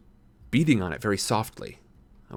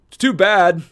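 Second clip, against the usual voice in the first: Tom's voice; 8 semitones higher, 7 dB louder than usual. A narrator's reading. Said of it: "he said".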